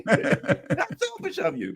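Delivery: slow, high, laughing scold; mock scolding. Host mock-scolding the guest, laughing slowly. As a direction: tease him while laughing. Joking reply to Garland.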